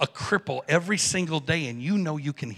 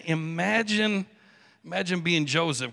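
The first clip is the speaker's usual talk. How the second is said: usual speaking voice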